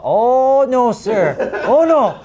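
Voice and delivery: very high, loud fretting servant wail; panicked butler. Acted. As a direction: whimper it out like a terrified butler. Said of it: "And you hear him say".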